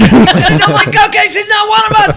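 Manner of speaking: high loud mock alarm; hammed-up alarm. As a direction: declare it in fake panic.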